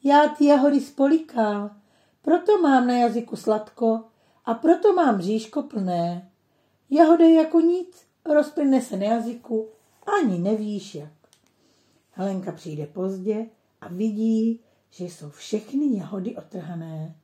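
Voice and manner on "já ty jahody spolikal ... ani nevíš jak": higher, louder puppy voice, pleased with himself; lightly voiced eager puppy; little dog voice. A narrator's reading. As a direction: play a small cheerful dog explaining. Puppy voices his realization.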